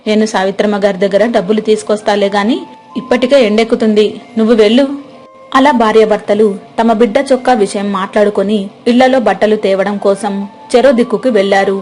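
ordinary delivery, nothing marked

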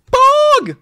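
cracking very high shout of Pog. Pretend. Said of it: "Voice crack".

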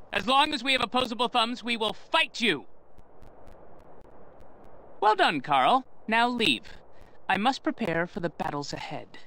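ordinary talk, nothing marked

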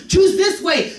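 high, shouted exhortation; urgent shout. Real, not acted. Preacher urging listeners toward God's way, shouting. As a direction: call it out to the crowd.

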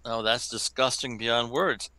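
ordinary talk

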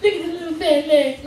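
put-on high, soft cooing over a cute baby; cooing baby talk. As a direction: gush sweetly at the chubby cheeks. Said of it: "They're like".

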